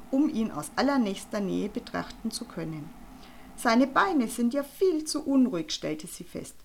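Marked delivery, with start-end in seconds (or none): 3.5-5.7 bossy. Acted. Frau Panzer belehrt.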